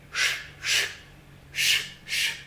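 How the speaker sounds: soft breathy shh strokes like a broom; hushed sweeping noise; imitated broom sweeping. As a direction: sound out a broom on stone. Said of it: "Somebody is sweeping the floor".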